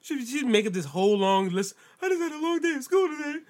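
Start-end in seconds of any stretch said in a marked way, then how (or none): 2.0-3.4 high, softer whiny-partner imitation; mimicking complaint. Man mimicking his partner's long list, mock complaining. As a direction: lift the pitch to mimic someone's complaint.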